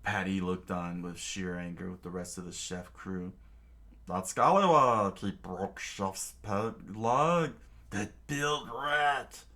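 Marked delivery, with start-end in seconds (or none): 4.1-9.5 gruff angry cook, slow and loud on the insult. Acted. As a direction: spit the words out at the traitor. Angry cook curses the culprit.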